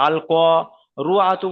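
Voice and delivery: "করুআতু" with a rounded ru in place of ri; drawn-out ক, then "রু" with a u sound; wrong vowel. Shown as a demonstration. Teacher showing what will not work, "রু" for ri. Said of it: "তাহলে ওখানে হবে না".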